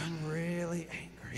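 mom whispering. Retold or imitated. Mom tells son she is angry, whispered. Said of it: "She would say".

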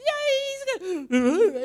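high, loud, slow mock wail; wobbling, shaking prophecy. Zambian lady shaking as she prophesies, wobbling wail. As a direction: wobble and gasp through the words, imitating. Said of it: "and she wobble and shake".

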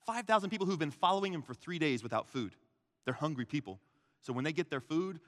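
ordinary talk

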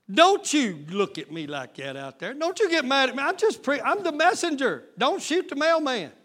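high, loud protest of the innocent messenger; mock pleading messenger. Preacher begging the congregation not to get mad at him. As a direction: plead don't blame me, I'm only the mailman.

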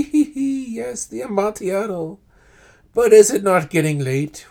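high, giggling voice of the trapped man; high cackle sliding into a plea; Fortunato's voice. A narrator's reading. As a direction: laugh nervously, then beg to leave. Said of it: "The voice said".

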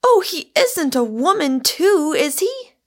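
alarmed disbelief. A narrator's reading. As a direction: voice rising in shocked disbelief.

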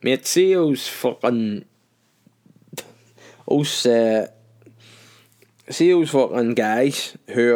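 slow, slurred pub rant; drunk rant. Pretend. A tipsy man ranting at his mate about politics, slurred and slow. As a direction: lean into the boozy know-it-all. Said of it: "you start saying things like".

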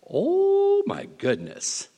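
high, slow amazed gasp of words; awed exclamation. Real, not acted. Awed at the scripture.

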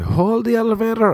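hammed-up drag queen voice; lightly voiced camp shout from down the hall; high mock drag queen call.

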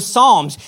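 "Psalms" with the silent P voiced, like puh-salms; P sounded in Psalms. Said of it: "supposed to pronounce the P at the beginning".

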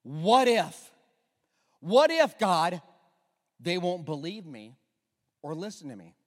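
anxious pleading. Acted. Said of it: "And he says".

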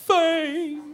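fame sung out high like a showman; high, show-host delivery of Fame; showy high call. Shown as a demonstration. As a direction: call the word out high and bright. Host repeats word with flair.

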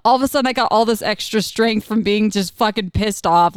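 normal talking voice

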